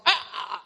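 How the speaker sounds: mock alarm gasp; mock gasping yell. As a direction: startle him with a fake gasp. Waking the sleeping paramedic.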